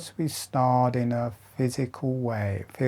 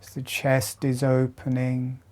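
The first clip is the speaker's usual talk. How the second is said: guided meditation; 4 dB quieter than usual. Acted. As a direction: lead them gently through each breath. Guides slow jaw breathing exercise.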